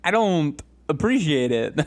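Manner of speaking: Christopher Walken. Acted. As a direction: complain of pain in broken-up phrases, hammed-up. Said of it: "Christopher Walken, but he's getting his eyes gouged out".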